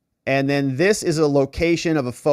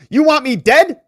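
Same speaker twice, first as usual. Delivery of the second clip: much higher and louder, stunned; mock outraged cry; 13 semitones higher, 9 dB louder than usual. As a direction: shout the question at her. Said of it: "Hunter says to her".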